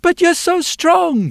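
put-on very high, slow child's plea; a small girl looking up in awe; little girl. Girl pleading with the blacksmith, very high and slow. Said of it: "she says".

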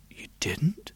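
breathless stunned whisper; shocked whisper. Acted. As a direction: whisper it in disbelief. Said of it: "said the wheelwright".

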